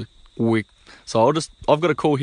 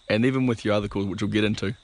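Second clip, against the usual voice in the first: normal talking voice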